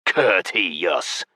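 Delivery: goblin drawling the word with a rising end; jeering creature drawl; mocking drawl. A narrator's reading. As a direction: draw it out in mockery. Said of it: "the goblin drawled mockingly".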